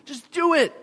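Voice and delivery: loud, high exasperated urging; acted-out worked-up outburst. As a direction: plead with them in loud frustration. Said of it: "I get all worked up".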